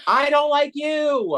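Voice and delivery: high, slow, playful fake diss; mock hostile. Host jokingly snubs a friend who dislikes Insidious, mock hostile. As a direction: pretend to be offended, hamming it up. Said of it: "Y'all are beefing".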